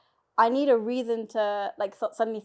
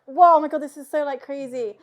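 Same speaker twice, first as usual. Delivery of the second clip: louder mock whoa of amazement; 4 semitones higher, 9 dB louder than usual. Imagining the mum's stunned reaction, loud mock amazement. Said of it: "have this moment of, like".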